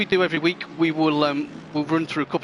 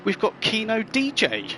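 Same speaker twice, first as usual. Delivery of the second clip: announcer intro; 8 semitones higher than usual. Acted. Introducing the interview guest.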